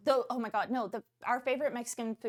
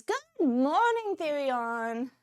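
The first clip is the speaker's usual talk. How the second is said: high, slow, drawn-out greeting; sing-song greeting; 3 semitones higher, much slower than usual. Real, not acted. Streamer greeting an arriving viewer, high drawn-out call. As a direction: swoop up high and stretch it.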